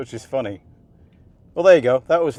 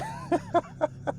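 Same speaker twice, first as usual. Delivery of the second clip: high giddy laugh; 10 dB quieter than usual. Real, not acted. After the hot lap.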